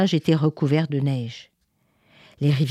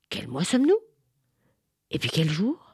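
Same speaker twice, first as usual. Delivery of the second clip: confused whisper; 5 dB quieter, faster than usual. Acted. Confused winter asks quietly.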